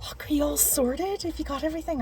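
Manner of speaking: whispered coworker question. Retold or imitated. Coworker voiced in story.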